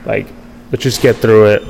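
retold brave-face reassurance; trying to stay positive. Injured pitcher reassuring himself, mildly upbeat. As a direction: brush it off with mild forced optimism. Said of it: "I'm trying to remain positive".

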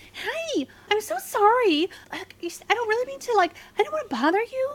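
high, squeaky mock apology. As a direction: imitate a squeaky-voiced busybody apologizing. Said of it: "little Miss Squeaky Voice comes back around".

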